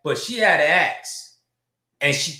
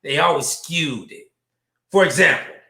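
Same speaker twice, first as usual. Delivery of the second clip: ordinary delivery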